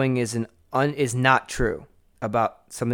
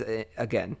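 ordinary talk

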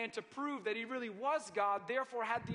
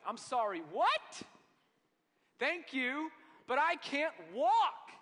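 higher and louder, mock disbelief; incredulous man; 6 semitones higher, 8 dB louder, slower than usual. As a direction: play the confused paralyzed man. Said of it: "I would have been like".

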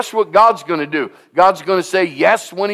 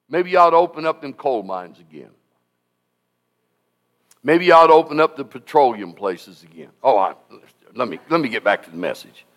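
usual speaking voice

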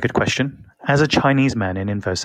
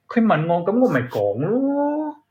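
Cantonese aside; 9 semitones higher than usual. Acted.

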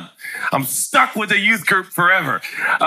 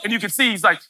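ordinary talk

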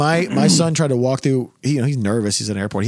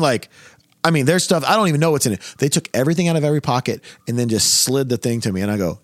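usual speaking voice

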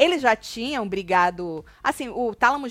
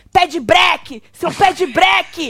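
mock shout; 5 semitones higher, 7 dB louder than usual. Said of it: "chamou ele de".

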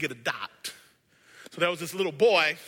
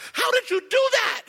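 put-on high, bright little boy asking; a small boy, amazed and hungry to know; high-pitched boy's question; eager boy voice; 7 dB louder than usual. Boy asks the girl.

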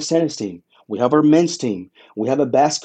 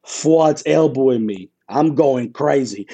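normal talking voice

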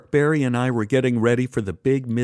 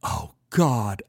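gasped. Acted. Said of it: "I gasped".

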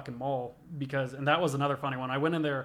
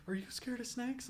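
put-on high, soft scared-girl voice; mock nervous girls; 9 semitones higher, 11 dB quieter than usual. Voicing the pet store girls.

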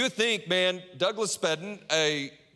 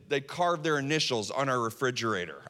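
ordinary talk, nothing marked

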